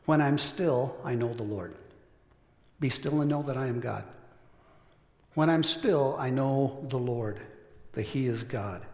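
soft reflective. Acted. Quiet meditative quote.

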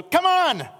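very high, loud come-on shout; one big exasperated call; exasperated cry. Retold or imitated. Husband's last loud push to get his wife up.

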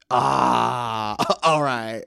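mock yelling. Ref answering a competitor's complaint, mock yelling. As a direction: imitate a ref's outburst, half laughing. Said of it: "Why are you yelling?".

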